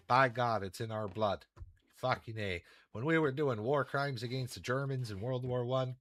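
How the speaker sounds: a lightly played Canadian hoser, proud and boastful; Canadian hoser rant. Streamer bragging to chat in a mild Canadian hoser accent. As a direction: brag about granite like a Letterkenny hoser.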